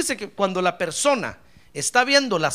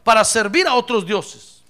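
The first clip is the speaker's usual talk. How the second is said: loud, raised proclamation of a crowd; people's emphatic declaration; 5 semitones higher, 9 dB louder than usual. Acted. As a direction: declaim it forcefully as a people's vow. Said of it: "el pueblo le respondió y le dijo". (proclamación enérgica del pueblo)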